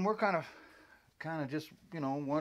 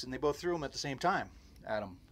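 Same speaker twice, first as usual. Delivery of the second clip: usual speaking voice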